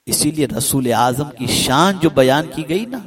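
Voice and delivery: normal talking voice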